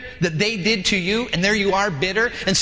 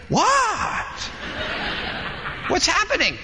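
clueless surprise; 9 semitones higher, slower than usual. Acted. Clueless driver reacts.

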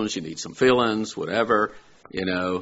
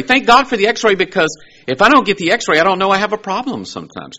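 higher, louder, quickening sermon drive; fervent preaching; 10 semitones higher, 6 dB louder than usual. Acted. Preacher urging his listeners, fervent and rising. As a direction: lift it up with fervent thanks.

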